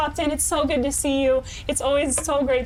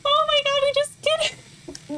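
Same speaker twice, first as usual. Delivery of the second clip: high, stunned victory cry; overjoyed disbelief; 10 semitones higher than usual. Real, not acted. Streamer celebrating a deathless run, overjoyed. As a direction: let the win pour out high and slowing.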